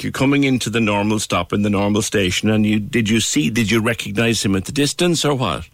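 usual speaking voice

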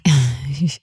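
a soft, low chuckle; short laugh. Real, not acted. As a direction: laugh lightly at the scare. Laughing off her daughter's fall.

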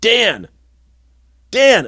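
acting out someone calling from another room, building; imitated calling out. Imitating Shanna calling out for him.

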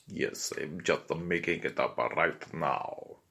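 put-on low, slow Russian accent; improvised Russian accent. Madame Blavatsky confessing she is making it up, improvised Russian accent. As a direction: makeshift Russian voice, kept down low. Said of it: "this is my improvised Russian accent".

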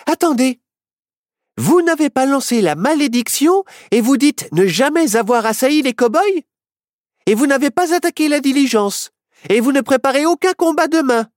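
put-on high, eager young girl; excited girl voice. A narrator's reading. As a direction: push it up high and urgent. Heroine explains, excited.

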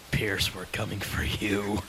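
slow, low demonic whisper. Pretend. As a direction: menace them like a demon. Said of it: "most demonic voice possible".

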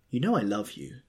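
ordinary delivery, nothing marked

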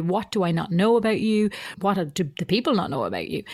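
normal talking voice

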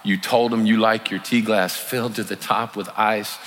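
ordinary delivery

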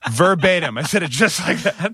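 giggling confession; laughing. Real, not acted. Laughing while admitting it.

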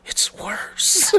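hushed, voiceless punchline; ominous whisper. Acted. Old man admitting combat is worse, whispered. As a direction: drop to a whisper for the grim reveal.

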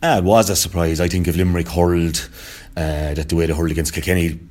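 ordinary talk, nothing marked

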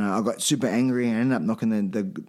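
normal talking voice

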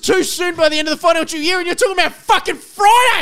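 loud exasperated protest; indignant shout. Real, not acted. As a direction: raise your voice in disbelief. Heated argument about filming.

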